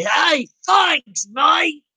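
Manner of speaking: a high mock shout-out in an Aussie accent; shouted thanks. Shown as a demonstration. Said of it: "shout out and say".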